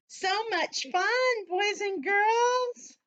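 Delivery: slow, lifted sing-song to the class; drawn-out cheer. Acted. Teacher telling the class how fun it was, slow. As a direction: stretch it for the kids.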